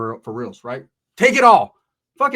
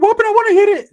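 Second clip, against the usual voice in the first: much higher, louder squeaky whine; mock Ricky voice; 11 dB louder than usual. Narrator voicing Ricky begging to hit the joint. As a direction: pitch it way up like a goofy kid.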